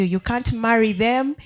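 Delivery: usual speaking voice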